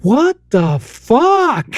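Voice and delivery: put-on high, drawn-out toddler amazement; thrilled little kid. Toddler daughter at the tenth present, innocently thrilled. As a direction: innocently swear in a slow, delighted swoop. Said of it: "same tone".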